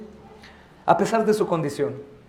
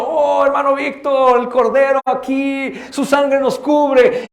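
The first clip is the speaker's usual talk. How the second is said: calm assurance; 6 semitones higher, 3 dB louder, slower than usual. Retold or imitated. Calm family sharing Passover meal.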